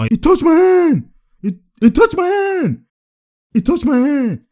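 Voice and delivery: loud, insistent complaint on repeat; hollered plea to the referee; shouted protest. Acted. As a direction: yell it as an indignant player's appeal. Shouts a disputed call.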